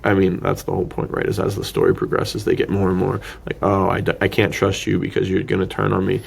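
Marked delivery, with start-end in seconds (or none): none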